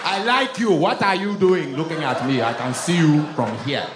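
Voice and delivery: put-on loud, commanding Nigerian accent; bossy mock Nigerian man; Nigerian man ordering a woman. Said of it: "they would talk to women crazy".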